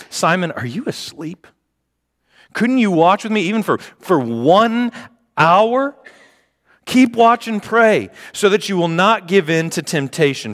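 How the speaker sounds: high, exasperated Jesus; exasperated rebuke. Acted. Jesus scolds the sleeping Simon, high and exasperated.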